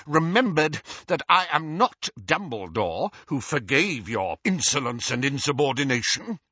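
stern dressing-down in a character voice; angry Minister. Acted. Minister puts an insolent boy in his place, stern. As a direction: rebuke him, building in pitch, then dropping.

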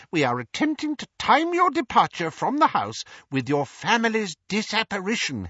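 high, eager little wizard voice; a chirpy, over-polite wizard explaining the plan; Daedalus's high, excitable voice; fussy wizard Daedalus. A narrator's reading.

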